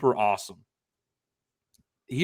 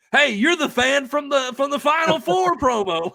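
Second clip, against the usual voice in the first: high, louder fan calling out to him; excited fan shout; 12 semitones higher, 4 dB louder than usual. Retold or imitated. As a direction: call it out like a fan spotting a celebrity. Fans recognizing him at games.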